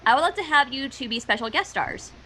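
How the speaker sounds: ordinary talk, nothing marked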